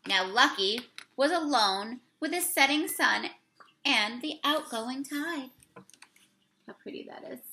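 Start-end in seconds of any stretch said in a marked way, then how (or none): 0.1-5.5 slow, louder read-aloud voice; storybook read-aloud. Acted. Teacher reading the picture book to the class, slow read-aloud. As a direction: read the page out to the children, slowly.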